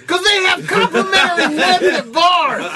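very high, very loud yell; mock over-explaining shout. Comedian explains the bar joke's punchline, shouting. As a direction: bellow the explanation with hammed-up hilarity.

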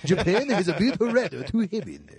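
hammed-up Japanese accent reporter; mock Japanese reporter accent. South Park Japanese reporter bit.

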